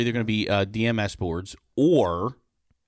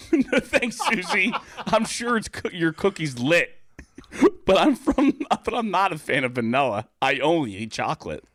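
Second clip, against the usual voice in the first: higher, laughing as Jim; laughing through line; 6 semitones higher than usual. Acted. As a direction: giggle through Jim's refusal, pitched up. Rereads Jim's line, laughing.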